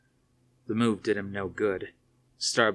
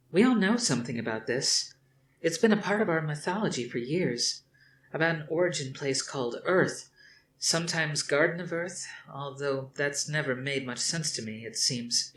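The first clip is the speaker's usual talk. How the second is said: put-on lightly higher, doubtful young woman; young woman's voice; 7 semitones higher than usual. A narrator's reading. Athena telling her father Earth is a myth, lightly voiced. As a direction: shrug off the myth.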